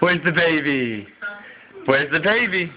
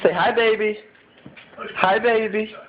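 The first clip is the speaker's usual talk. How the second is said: high sing-song coaxing; 5 semitones higher, faster than usual. Real, not acted. Parent coaxing child to greet.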